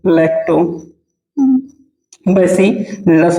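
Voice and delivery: Lactobacillus broken into parts with long pauses; lactobacillus at writing-down speed; slow dictation. Shown as a demonstration. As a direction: dictate lactobacillus very slowly, piece by piece. Teacher dictating term for notes.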